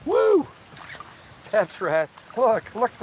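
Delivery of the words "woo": excited whoop. Real, not acted. Whoops on camera.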